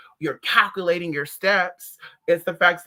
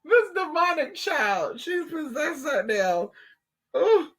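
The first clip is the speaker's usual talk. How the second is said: high, slow, laughing lament; laughing complaint; 9 semitones higher, slower than usual. Real, not acted. Telling viewers the child is possessed, slow and laughing. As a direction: drag it out while cracking up.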